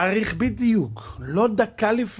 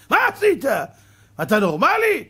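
rebuke; 5 semitones higher, 5 dB louder than usual. Acted. God rebukes the soul.

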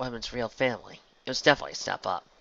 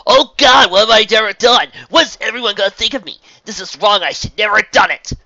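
mocking impression; 11 semitones higher, 18 dB louder than usual. A narrator's reading. Mocking her partner's panic.

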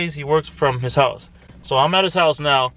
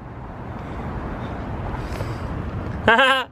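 breathy laugh; 7 semitones higher, 5 dB quieter than usual. Real, not acted. Laughs at sticker.

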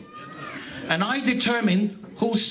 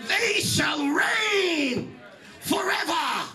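higher, shouted gospel preaching with slow stretched calls; preaching at full force; 5 semitones higher than usual. Acted. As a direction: call out to the congregation like a revival preacher. Preaching climax, seats for every nation.

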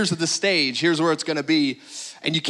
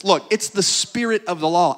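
ordinary talk, nothing marked

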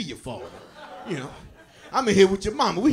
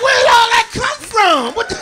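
shocked outburst; 7 semitones higher, 5 dB louder than usual. Retold or imitated. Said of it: "I'm like".

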